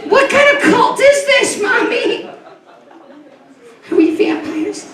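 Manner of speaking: put-on high, slow, horrified little kid; frightened child. Child at communion questioning his mommy, high and slow. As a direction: ask it wide-eyed, a scared child.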